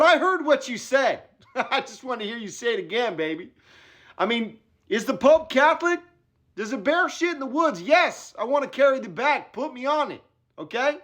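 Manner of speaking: high, breathless excitement; eager. Retold or imitated. Caddy gushing to his agent about carrying Wahlberg's bag. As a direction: jump at the job like a kid.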